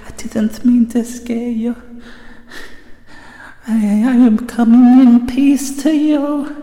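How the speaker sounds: high-pitched, drawn-out pleading with a ghost; silly put-on voice. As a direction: placate the spirit in a squeaky high voice. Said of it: "I don't know why I'm doing this voice".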